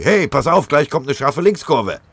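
loud, high urgent call to the driver; shouted warning. Acted. Soldier warns the driver of a curve, shouting. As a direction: yell it out, eyes on the road ahead.

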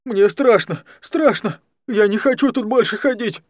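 high, loud frightened cry. Acted. Scared Даня begs Алекс to let him stop, high and loud. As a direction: plead to get out, scared and loud.

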